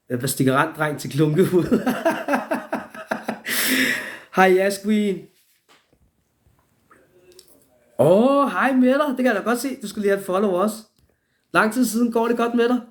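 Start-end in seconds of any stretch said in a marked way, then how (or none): 1.8-4.3 very high wheezing laugh; real helpless high laughter; big laugh. Real, not acted. Laughs at own joke. 4.3-12.8 high, loud, delighted hello; gushing high-pitched welcome; effusive greeting. Real, not acted. Effusively greeting viewers joining the live.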